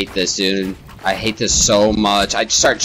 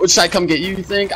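nervous pitch-up; 9 semitones higher, faster than usual. Real, not acted. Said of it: "I'm so scared".

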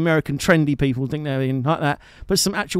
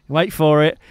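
usual speaking voice

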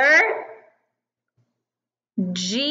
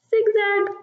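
high sing-song; 7 semitones higher than usual. Shown as a demonstration. Teacher shows letter Z.

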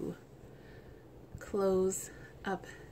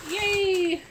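high, loud happy cheer; a burst of joy, pitched way up; excited cheer; 12 semitones higher, 11 dB louder than usual. Real, not acted. Cheering as the paper slips out, high and loud.

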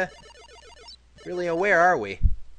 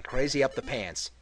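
usual speaking voice

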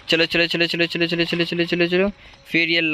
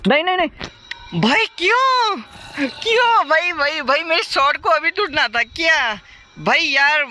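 frustrated outburst; 10 semitones higher than usual. Real, not acted. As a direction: yell out in disbelief. His shot breaks mid-game. (ऊँची, ज़ोरदार झुंझलाहट भरी चीख)

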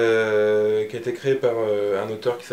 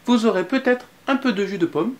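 higher, lightly voiced little boy; child voice; 8 semitones higher than usual. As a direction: play Anton offering treats sweetly. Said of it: "dit Anton".

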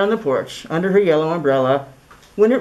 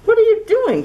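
put-on high, loud child's shout; high girl's outcry; 3 dB louder than usual. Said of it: "she shouted".

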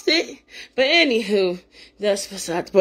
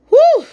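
joyful whoop; 9 dB louder than usual. Real, not acted. Praise outburst.

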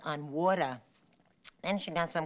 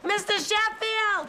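panicked call; 13 semitones higher, 3 dB louder, slower than usual. Acted. Panic on finding the dog.

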